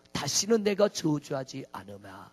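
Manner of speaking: low, solemn divine promise; voice of God. Acted. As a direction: speak as God, gravely. God's voice quoted in sermon. (하나님의 낮고 엄숙한 목소리)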